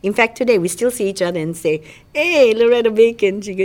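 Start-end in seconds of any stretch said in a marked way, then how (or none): 2.1-3.4 loud, higher-pitched playful call; hailing a friend. Retold or imitated. Old classmates greeting each other, loud hailing. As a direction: call out a teasing hello.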